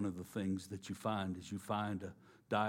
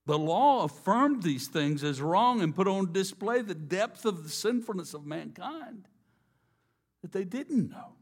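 loud, higher, ringing sermon voice; preaching at full force; 4 semitones higher, 5 dB louder than usual. Acted. Preacher convicting the congregation of sin, loud and high. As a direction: press the congregation hard.

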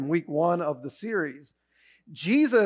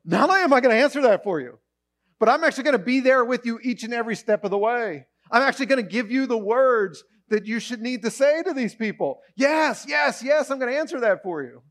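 higher, louder quick rising joy; exuberant yes; 5 semitones higher, 3 dB louder than usual. Retold or imitated. God joyfully promising to be there. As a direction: build to a cheering yes. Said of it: "God's going".

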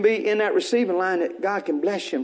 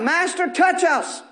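lepers crying out; 6 semitones higher, slower than usual. Acted. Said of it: "they cried out".

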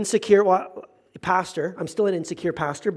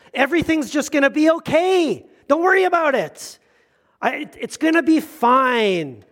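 higher, bright mock-reassuring voice; cheery optimist; 4 semitones higher than usual. Pastor imitates a relentlessly positive friend, sing-song cheer. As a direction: play the breezy optimist brushing worry off. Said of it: "people on the opposite side".

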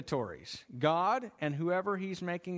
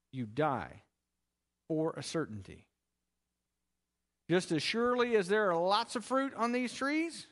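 ordinary delivery

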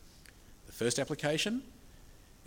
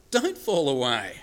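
Don't fall away said slowly and louder, leaning on Don't; emphatic plea; 6 dB louder, slower than usual. Shown as a demonstration. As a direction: plead it slowly with weight. Preacher repeats the answer emphatically.

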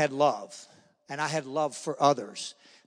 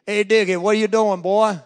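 big loud, higher doorstep greeting; imitated hearty hail; 4 semitones higher, 9 dB louder than usual. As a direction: holler it as big old John. Big John greets him at door.

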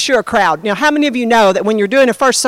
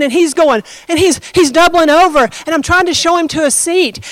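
normal talking voice

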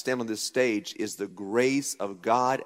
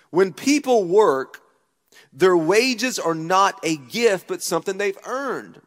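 slow, loud preached reading; scripture reading; 6 semitones higher, 6 dB louder than usual. Acted. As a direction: declaim the verse slowly over the music. Reading scripture slowly over music.

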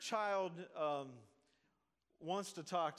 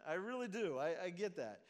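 normal talking voice

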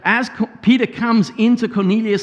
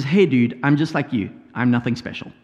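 lightly voiced laid-back Peter, dropping lower and softer; casual paraphrase; 8 semitones lower, 3 dB quieter than usual. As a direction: say it like a relaxed modern buddy. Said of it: "Brian's paraphrase is".

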